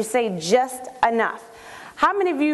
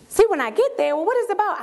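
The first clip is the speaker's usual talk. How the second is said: high, pushy questioning; incredulous friend; 7 semitones higher than usual. Acted. Friend pressing the caller for details, incredulous. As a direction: throw it back in disbelief.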